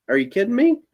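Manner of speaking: high, mock disbelief; incredulous. To friends inviting him to play, mock incredulous. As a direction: scoff at the invitation.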